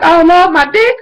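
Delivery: high, wailing mock despair; mock panic. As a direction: ham up a panicked wail. Mock-voicing a panicking character.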